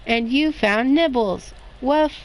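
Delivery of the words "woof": a high, bright single bark; happy little puppy woof. A narrator's reading. Puppy barking happily at the end of the story.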